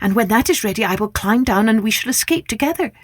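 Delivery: put-on high, fast girl's voice; eager young girl. Rapunzel answers the prince's proposal, high and eager. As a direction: gush it out, eager to escape. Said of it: "she agreed at once".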